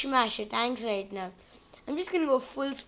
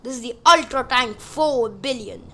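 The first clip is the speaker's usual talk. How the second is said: put-on Soviet announcement; hammy Soviet accent; 4 dB louder than usual. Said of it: "a Soviet accent".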